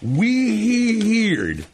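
high, loopy, drawn-out WEIRD; mocking. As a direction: stretch it into a wobbly wee-hee-heerd. Host teasing sidekick as weird.

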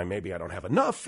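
low, muttering the worried gambler; scared poker player. Retold or imitated. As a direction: count your chips under your breath, worried. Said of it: "And I".

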